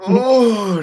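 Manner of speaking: high strained groan; stretching groan. Real, not acted. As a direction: let out the stretch. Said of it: "it was intense".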